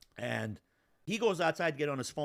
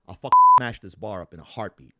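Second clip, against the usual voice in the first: loud threat; 4 semitones lower, 16 dB louder than usual. Retold or imitated. Threatening the rival in bar.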